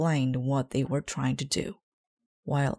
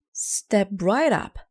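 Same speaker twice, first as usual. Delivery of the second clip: carnival barker; 5 semitones higher, 4 dB louder, slower than usual. A narrator's reading. Barker calling to passing cars.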